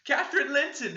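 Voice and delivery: put-on high, loud, trembling child's voice; a cold little ghost naming herself; shivering ghost child. Ghost child answering through the window, high shivering. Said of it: "it replied, shiveringly".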